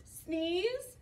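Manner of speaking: plain reading voice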